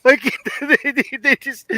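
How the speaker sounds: giddy, laugh-broken telling; cracking up. Real, not acted. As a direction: struggle to talk through real laughter.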